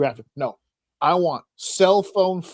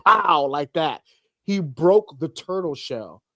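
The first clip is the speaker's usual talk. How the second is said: normal talking voice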